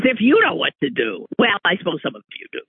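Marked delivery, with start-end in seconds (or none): none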